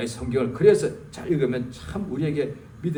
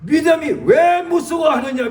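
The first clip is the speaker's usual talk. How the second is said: high, loud stress on 왜; loud rebuke; 9 semitones higher, 7 dB louder than usual. Retold or imitated. Jesus answering the praying believer, rebuking loudly. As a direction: demand it, punching 왜 hard. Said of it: "예수님께서 뭐라고 응답하셨냐면".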